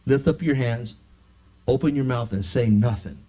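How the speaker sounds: low, soft, understated instructions; gentle minister. Retold or imitated. Minister instructing a woman at the altar, retold low and soft. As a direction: imitate a minister calmly keeping it hushed and low.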